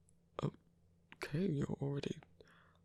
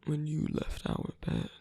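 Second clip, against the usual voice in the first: ordinary talk, nothing marked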